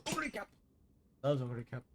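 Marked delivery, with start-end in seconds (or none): 0.1-0.5 high, excited mock call; imitating Zaya's hype. Streamer mimicking Zaya's excited call. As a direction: squeal it like a glazing fan. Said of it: "Zaya goes".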